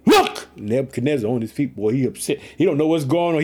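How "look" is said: high, startled cry of "look"; shouted exclamation. A narrator's reading. King calling out to his advisers, shouted. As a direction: call it out sharply, much higher. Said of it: "Nebuchadnezzar shouted".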